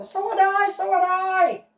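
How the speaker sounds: high and loud, eager yapping dog voice; excited dog. Acted. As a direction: bounce it out like an overexcited puppy. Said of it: "said Pluto".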